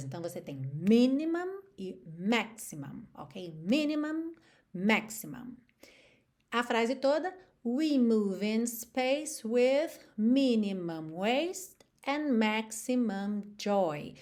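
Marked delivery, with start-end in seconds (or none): 3.5-5.6 "Minimum" and "maximum" stressed on the first syllable; first syllables punched. Shown as a demonstration. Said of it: "sílabas tônicas são as primeiras". 7.6-14.1 slow rhythmic delivery of the lyric; slow metred line. Shown as a demonstration. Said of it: "Já tô fazendo aqui na métrica".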